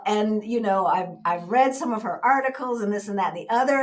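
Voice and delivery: normal talking voice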